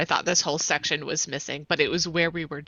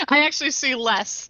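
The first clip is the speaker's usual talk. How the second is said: higher, amused laughing delivery; 8 semitones higher, 5 dB louder than usual. Real, not acted. Player admitting to the game master they see less, laughing. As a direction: say it through real laughter.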